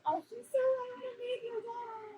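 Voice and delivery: retelling her own gush over the daughter, pitched high; excited gushing. Retold or imitated.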